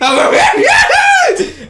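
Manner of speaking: very high, loud yelp; startled shout. Acted. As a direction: cry out as she grabs you. Being squeezed.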